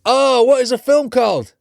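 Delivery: high, loud frustrated groan of recall; exasperated. Real, not acted. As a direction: wail it as you rack your brain. Straining to recall a film title.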